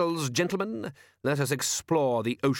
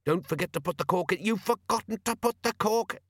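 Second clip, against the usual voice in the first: high squabble, rising as it sinks; distorted high voices from under water; bubbling underwater voices; 8 semitones higher than usual. A narrator's reading. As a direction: argue in a climbing, burbling pitch. Bickering voices erupt from sinking bubbles.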